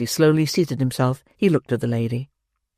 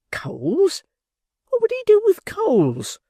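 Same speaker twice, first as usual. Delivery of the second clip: much higher, mock incredulous; mocking the pauper; slower than usual. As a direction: ape the pauper with scorn. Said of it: "he says".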